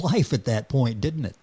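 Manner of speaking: ordinary delivery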